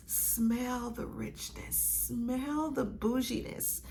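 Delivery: soft, airy half-whisper; savoring sigh. Real, not acted. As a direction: breathe it out while inhaling the scent. Sniffing the perfume, savoring it.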